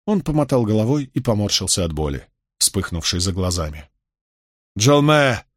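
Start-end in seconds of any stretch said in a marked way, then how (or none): 4.8-5.4 loud call across the camp. A narrator's reading. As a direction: call his name out loud. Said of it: "позвал он".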